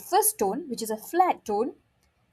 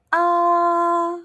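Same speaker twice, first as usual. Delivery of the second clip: AH held high and flat, loud and steady; AH in a straight, unwavering high line; first tone; 7 semitones higher, 6 dB louder than usual. Shown as a demonstration. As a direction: sustain AH on one level high pitch. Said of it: "it goes flat".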